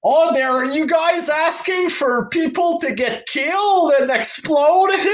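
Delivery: high mocking whine; mocking Tony Khan. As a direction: sneer it in a high fake voice. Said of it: "It's not a thing that people".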